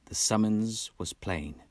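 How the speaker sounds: plain reading voice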